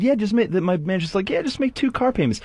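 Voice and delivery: very high, breezy mock manager voice. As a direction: toss it off like a slick boss.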